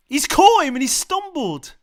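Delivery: loud, high, slow outraged yell; real angry yelling, slow; angry shout. Real, not acted. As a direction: spell out the foul in fury. Replaying the foul, still raging.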